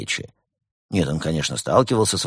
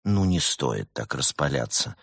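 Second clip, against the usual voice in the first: soft, slow and lower, with a smile; sweetly smiling; 6 semitones lower, 4 dB quieter than usual. A narrator's reading. Good cop lieutenant calms down with a smile. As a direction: soothe him sweetly. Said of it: "мило улыбаясь".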